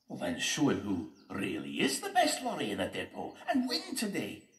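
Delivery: loud rallying character voice; spirited pep talk; Jock the Tanker, encouraging. Acted. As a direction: urge a friend on, rising high at the end.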